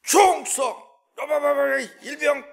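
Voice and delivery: crisp barked salute; korean military style. Retold or imitated. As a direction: shout it like a private saluting. Soldier salute shout.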